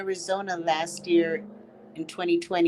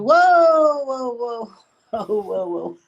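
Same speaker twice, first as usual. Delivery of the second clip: put-on teasing baby call; 4 semitones higher, 4 dB louder, slower than usual. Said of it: "they're gonna call you".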